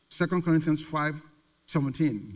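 ordinary delivery

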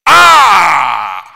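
a loud, high exclamation; preacher's shout to the church; loud preacher's cry. Real, not acted. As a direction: let out a big cry. Preacher cries out.

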